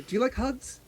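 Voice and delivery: put-on high, soft little-girl ask; childlike question. Little sea elf asking Acacia for a hug, high and soft. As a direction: ask sweetly like a small child. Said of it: "So she'll start with Acacia".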